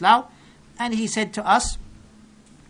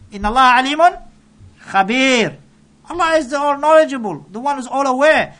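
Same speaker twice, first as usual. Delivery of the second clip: higher and louder proclamation; rising climax; 5 semitones higher, 8 dB louder, faster than usual. Real, not acted. Preacher proclaiming Allah knows all, high and loud. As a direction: proclaim it to the hall.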